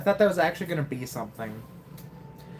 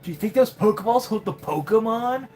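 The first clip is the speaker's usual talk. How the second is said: lightly voiced rival kid; 3 semitones higher than usual. Voices rival dialogue again.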